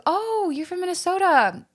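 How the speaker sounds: high, exaggerated Canadian accent. Hockey player greeting a Minnesotan, high Canadian accent. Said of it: "in a very Canadian accent".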